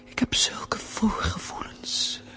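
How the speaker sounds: intense stage whisper, mostly voiceless; anxious soliloquy. Acted. Woman finally alone, fearing the stranger at supper, anxious whisper. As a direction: breathe out the secret dread, with long pauses between thoughts. Alone, confesses secret dread.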